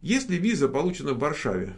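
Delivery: ordinary delivery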